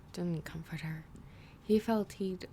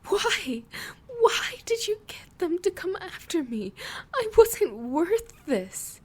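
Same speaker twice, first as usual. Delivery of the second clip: high, loud, anguished sobbing plea; distraught cry; 11 semitones higher, 7 dB louder than usual. Acted. Lily breaks down.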